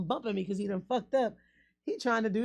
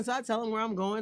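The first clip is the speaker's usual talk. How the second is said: usual speaking voice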